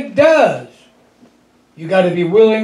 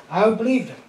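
"I would believe him" dropped much softer; softer echo; 7 dB quieter, faster than usual. Real, not acted. Preacher to congregation, quietly echoing his faith. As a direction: let the repeat sink in quietly.

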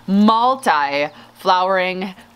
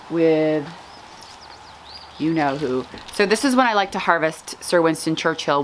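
ordinary talk, nothing marked

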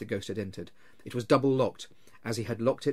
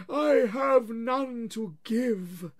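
high, slow, loud moaning spectre; wailing ghost; 12 semitones higher, 5 dB louder, much slower than usual. Acted. Marley's ghost wails at Scrooge, slow and loud. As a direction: drag each word out like a wail from the grave.

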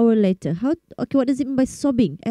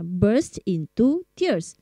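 very slow model phrase; 3 dB quieter, much slower than usual. Shown as a demonstration. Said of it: "B U R S T".

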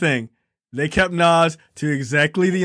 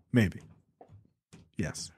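usual speaking voice

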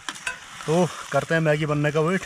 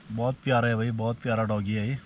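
ordinary delivery

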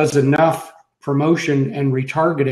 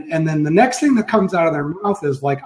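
usual speaking voice

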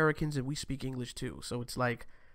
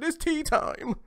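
mock posh Brit; 13 semitones higher, 3 dB louder, slower than usual. Mocking British tea time.

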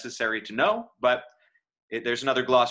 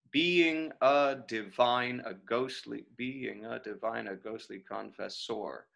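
slow scansion of "Being a divine", fading much softer; working out metre; 2 semitones lower, 6 dB quieter, slower than usual. Shown as a demonstration. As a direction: count out "Being a divine" as if to yourself. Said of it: "let's figure it out".

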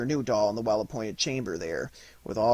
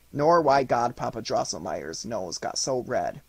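ordinary delivery

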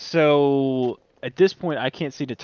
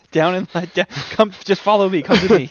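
raised voice, calling ahead; 6 semitones higher, 9 dB louder than usual. Acted. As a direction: call out to the group. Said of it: "You gotta yell".

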